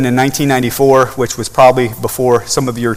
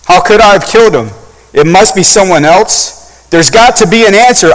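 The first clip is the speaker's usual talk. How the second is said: higher and louder, voice straining in disbelief; desperate denial; 6 semitones higher, 7 dB louder than usual. Acted. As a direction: refuse to believe your own guilt. Soldier's horrified denial.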